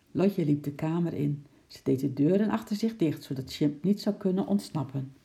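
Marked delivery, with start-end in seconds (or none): none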